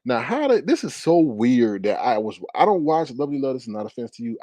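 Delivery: ordinary delivery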